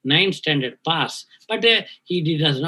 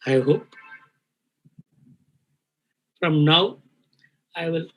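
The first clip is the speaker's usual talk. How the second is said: choked up, long pauses between words; fighting back tears, breath catching; emotional, catching breath. Real, not acted. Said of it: "I'm emotional".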